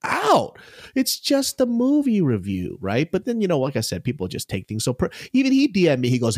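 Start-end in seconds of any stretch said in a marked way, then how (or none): 0.0-0.6 exasperated drawl. Retold or imitated. Mocking oversensitive critics.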